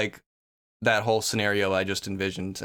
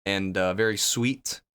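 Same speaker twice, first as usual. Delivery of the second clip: ordinary delivery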